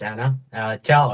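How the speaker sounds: ordinary delivery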